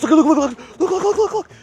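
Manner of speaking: real overexcited yelling; frantic excited shouting. Real, not acted. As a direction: urgently make everyone look. Frantic as bass attacks fish.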